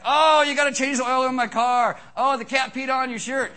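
high, loud mock-whiny nag; mocking nagging wife. Pastor mimics wives' chore talk during sex, high nagging mock. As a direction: lampoon a wife complaining about chores. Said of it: "some of you ladies I know when you're like".

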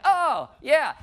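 high, loud mock "oh yeah" of a zoned-out listener; bored listener. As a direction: play the dazed listener, way up high. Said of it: "You're like".

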